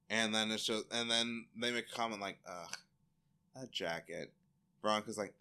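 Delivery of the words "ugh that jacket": mock disdain. Disdainful comment.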